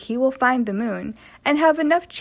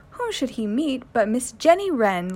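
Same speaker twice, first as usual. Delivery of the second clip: usual speaking voice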